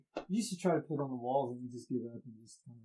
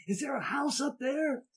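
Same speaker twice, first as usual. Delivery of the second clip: lost worker calling; 12 semitones higher, slower than usual. Retold or imitated. Lost worker shouting uphill.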